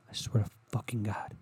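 quiet, menacing mock threat; a hint of a seething partner. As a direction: threaten under your breath. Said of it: "you go".

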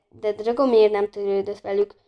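usual speaking voice

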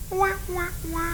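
mock wailing. Mock crying sound.

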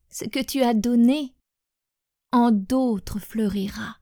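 measured, hushed poem reading with long pauses; poem recitation. Acted. Sharing a poem in homage to departed loved ones, measured recitation. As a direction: recite it line by line, letting each phrase settle.

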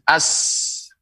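"as" with a long held s; merged article. Shown as a demonstration. Quran teacher shows lam becoming sin, merged article. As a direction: let the s run on in "as". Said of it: "lamnya dilebur menjadi".

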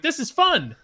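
high, giddy thrill behind the wheel; flooring the BMW just for fun; gleeful. Retold or imitated. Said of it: "'cause I'm like".

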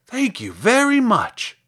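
high, loud, slow scarecrow thanks; thankful scarecrow. Acted. Scarecrow thanks girl for lifting him down, grateful.

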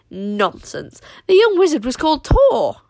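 loud, high scoffing retort; indignant denial. A narrator's reading. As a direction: dismiss it loudly as nonsense. Tsar denies it.

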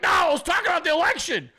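high frantic yelling excuse. Retold or imitated. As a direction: yell your defence in a rushed panic, half laughing. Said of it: "And you're like".

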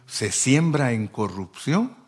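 usual speaking voice

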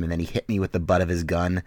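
normal talking voice

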